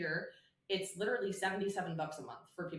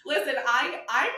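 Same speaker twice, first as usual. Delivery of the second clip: excited; 8 semitones higher, 11 dB louder than usual. Real, not acted. Laughing, excited reply.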